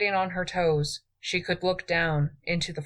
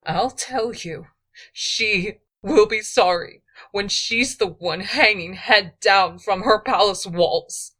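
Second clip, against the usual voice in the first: louder, higher furious threat; angry vow; 5 semitones higher, 6 dB louder than usual. A narrator's reading. Queen vows revenge on rival queen, angrily. Edis vowing revenge on Atolia.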